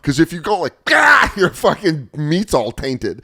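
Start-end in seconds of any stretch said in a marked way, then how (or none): 0.8-1.3 high, loud startled yell; abrupt mock scream of alarm; startled yelp. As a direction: mimic a sudden fright. Acts out startled cow before slaughter.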